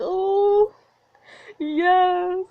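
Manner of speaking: high, loud thrilled oh yes; thrilled squeal. Real, not acted. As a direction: squeal with delight. Reader thrilled by the kiss.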